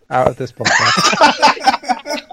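high, slow, laughing; laughing mock outrage. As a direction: drag it out while laughing. Mock banishing Ben laughing.

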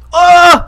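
loud, high mock cry of falling; fake startled yelp. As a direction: yelp as if pretending to topple over. Faking a fall.

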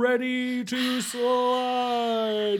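fighting game announcer. Pretend. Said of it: "You have your announcer voice on".

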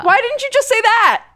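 very high-pitched mock outrage; mock exasperation. Co-host scolds host for wordy clue, mock-exasperated. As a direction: squeal it in playful protest.

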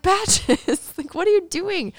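high, laughing mock scold at a pet; exasperated scolding. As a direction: scold the bird half-laughing. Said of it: "I was so mad".

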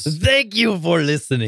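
put-on high, gruff Russian accent. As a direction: say it as Bruce in a thick Russian accent. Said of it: "in the Russian accent of Bear Hand Bruce".

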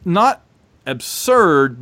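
ordinary talk, nothing marked

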